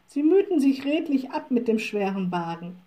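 ordinary delivery, nothing marked

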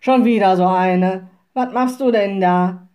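loud, gruff forester challenge; shouted. A narrator's reading. Forester confronts the resting witch, shouting. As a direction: call out sternly at a trespasser. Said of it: "rief der Förster".

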